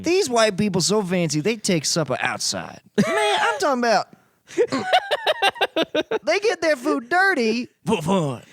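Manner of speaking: hammed-up comic routine with big pitch swings; exaggerated observational-comic voice; mock stand-up comedian. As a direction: imitate a baffled stand-up, stretching the words out.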